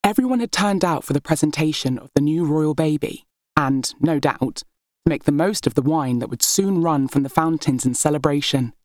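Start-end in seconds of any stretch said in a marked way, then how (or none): none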